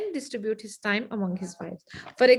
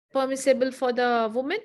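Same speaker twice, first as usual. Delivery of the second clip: ordinary talk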